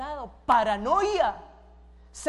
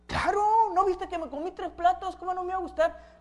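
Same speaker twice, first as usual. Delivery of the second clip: high, emphatic husband insisting; acted indignant assurance; exasperated protest; 8 semitones higher, 3 dB louder than usual. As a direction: insist it, pitched up, as if it's obvious. Acted husband protesting to doubting wife.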